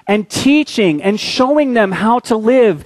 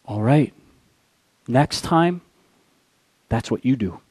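low, soft and steady; quiet lesson; 8 semitones lower, 7 dB quieter than usual. Retold or imitated. Colonel teaches the lieutenant a lesson, low and soft. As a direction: calmly drive the lesson home.

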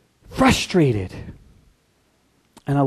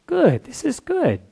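contented Jonah; 8 semitones higher, much slower than usual. Retold or imitated. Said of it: "Jonah's like".

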